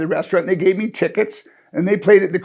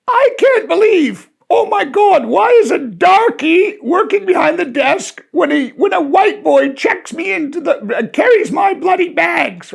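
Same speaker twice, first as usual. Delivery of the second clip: high, loud shrill-free outraged yell; loud, high, indignant ranting at the desk; mock outraged guest; 11 semitones higher, 6 dB louder than usual. Hotel guest shouting at the clerk, outraged and high. Angry racist guest yelling.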